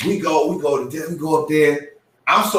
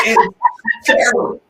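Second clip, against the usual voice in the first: laughing; 3 dB louder than usual. Real, not acted. Laughing at his own car.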